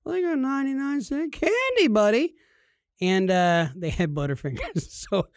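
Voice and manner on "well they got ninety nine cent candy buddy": playful dad. Retold or imitated. Dad voice to son.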